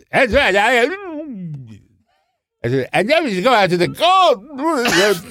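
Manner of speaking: hammed-up stage diva declaiming; imitating Tallulah. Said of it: "she would say".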